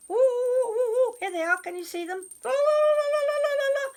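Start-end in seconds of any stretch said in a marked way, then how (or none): 0.1-1.1 loud, high run of oohs; high-pitched hooting; excited goblin whoops. Acted. Goblins tumbling in, acted. 2.4-3.9 loud, very high la-la-la; high, gleeful gibberish run; excited goblin babble. Acted. They were so excited.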